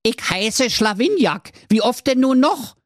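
high, annoyed servant voice; fed up with repeating his name; exasperated servant. Acted. Servant correcting the forgetful king, high and annoyed. Verdrehte entnervt die Augen.

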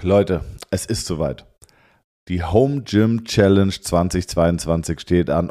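slow grand announcement; announcer voice. Acted. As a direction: proclaim it like a show host. Announcing the challenge.

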